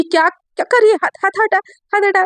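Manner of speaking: acted flustered scolding; flustered protest. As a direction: repeat it hurriedly, fending off a touch. Acted flustered objection to being touched.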